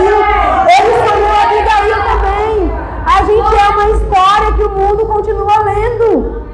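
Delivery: high, sustained preacher's delivery; impassioned preaching. Real, not acted. Preacher tells listeners God chose each of them, impassioned preaching. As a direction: press each declaration home to the congregation.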